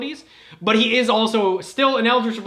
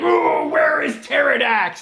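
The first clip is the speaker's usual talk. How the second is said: high, slowed shout of a confused hero; high goofy shout; 7 semitones higher, 6 dB louder, slower than usual. Acted. Confused hero yelling for his rival, high and slow. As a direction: call out like a lost dimwit. Toa Mizrax voiced in recap.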